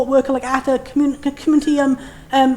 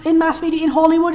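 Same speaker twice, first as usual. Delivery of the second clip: ordinary delivery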